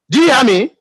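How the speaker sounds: ordinary talk, nothing marked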